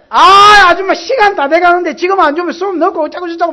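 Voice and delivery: loud, high angry yell at the waitress. Retold or imitated. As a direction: blow up, shouting that class is about to start. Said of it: "막 감을 질렀더니".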